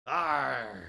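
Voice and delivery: loud pirate arr; pirate roar. Acted.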